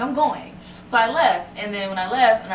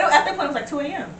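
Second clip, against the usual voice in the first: ordinary talk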